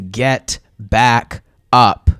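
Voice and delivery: ordinary talk, nothing marked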